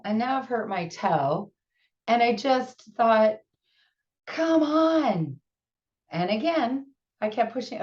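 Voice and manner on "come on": drawn-out, higher-pitched exasperated plea. Retold or imitated. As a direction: groan it out, fed up with mishaps. Retelling her exasperation at mishaps.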